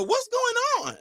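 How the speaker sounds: high falsetto wife's voice, puzzled; imitating his wife. Husband mimics his wife checking on the noise, in high falsetto. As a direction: squeak it out like a worried wife. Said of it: "my wife would sometimes go".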